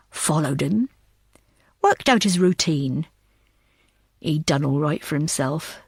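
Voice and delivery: put-on low, steady telling of an old memory; a hint of a cool, unhurried man; Samuel's voice.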